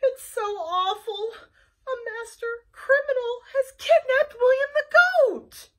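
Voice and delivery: high, loud, near tears; distraught girlfriend. Acted. Wanda telling Flytrap the goat was kidnapped, tearful. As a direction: wail the bad news.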